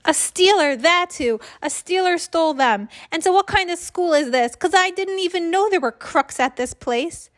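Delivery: high-pitched indignant outburst; worked-up child. A narrator's reading. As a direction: protest the theft, all worked up. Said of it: "Calm down, my voice".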